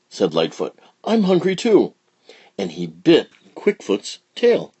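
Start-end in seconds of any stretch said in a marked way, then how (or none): 1.0-1.9 put-on squeaky child alligator; eager high child voice; high little alligator.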